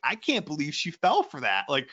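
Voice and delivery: high-pitched exasperated disbelief; mock indignation, pitch pushed up; imitating mad husband. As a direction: retell a husband's indignant outburst. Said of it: "and he was so mad".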